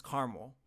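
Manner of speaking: "caramel" as CAR-mul, second a dropped; two-syllable caramel. Shown as a demonstration. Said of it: "that only has two syllables".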